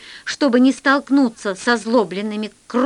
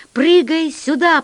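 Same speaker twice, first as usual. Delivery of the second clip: higher, louder command; urgent order; 5 semitones higher, 5 dB louder than usual. A narrator's reading. Pilot ordering narrator into the boat, urgent command. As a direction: call out a sharp order to jump. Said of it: "приказал мне Полосков".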